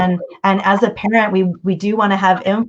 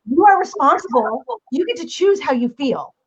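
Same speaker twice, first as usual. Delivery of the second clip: lightly voiced preachy hippie mom, higher; mild mock lecture, pitched up; 7 semitones higher than usual. Mom lecturing her bored son, a touch hippie and higher. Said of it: "get all hippie".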